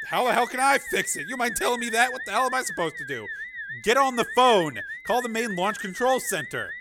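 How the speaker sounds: frustrated, high-pitched outburst; heated argument. Acted. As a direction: argue back in agitation. Crew argue over failing launch.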